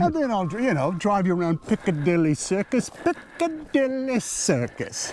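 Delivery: a hammed-up British guide showing off London; mock British tour guide. Joking about Americans taking British jobs, British guide accent. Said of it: "me and John Hamm going over and say".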